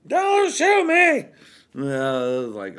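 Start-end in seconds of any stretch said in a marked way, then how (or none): 0.0-1.2 much higher, louder panicked shout; desperate high yell, slowing at the end. Retold or imitated. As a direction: scream it, terrified of being shot. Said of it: "he's like".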